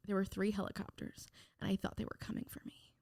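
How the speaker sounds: breathy whispered dread, voice almost gone; hushed whisper. Retold or imitated. To a podcast host, whispering the old paranoia. Fearful helicopter story.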